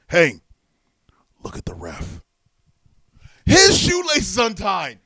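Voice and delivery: loud, slow, gleeful prank call; mock shout. Owen calling out the ref's untied lace, loud. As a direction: bellow it like a prankster. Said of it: "He goes".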